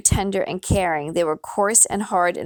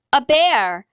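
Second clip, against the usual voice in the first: higher-pitched cry of alarm; a restrained narrator's scream, pitched up; 6 semitones higher, 4 dB louder than usual. A narrator's reading. Boy crashing into a bear, screamed in alarm. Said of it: "I heard someone scream".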